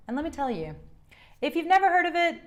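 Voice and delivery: ordinary talk, nothing marked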